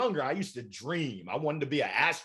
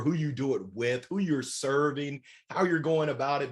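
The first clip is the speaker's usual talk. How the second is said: ordinary delivery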